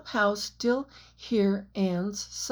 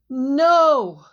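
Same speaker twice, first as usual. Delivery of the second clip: loud, high scream of grief; anguished scream; 5 semitones higher, 9 dB louder than usual. A narrator's reading. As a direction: Jack screaming in grief after Anne falls.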